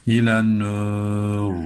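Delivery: "İlen nur" stopping on a heavy r, slow; thick ra. Shown as a demonstration. Teacher shows the heavy r in "İlen nur", slow. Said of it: "Öyle okuduk".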